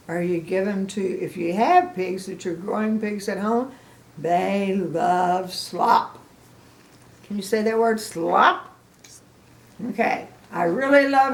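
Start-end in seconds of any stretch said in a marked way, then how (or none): 4.2-6.2 stretched teaching emphasis. Shown as a demonstration. Drawn out for kids.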